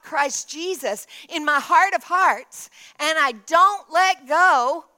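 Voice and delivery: loud, emphatic sermon climax; forceful preaching. Real, not acted. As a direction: drive each phrase home to the congregation.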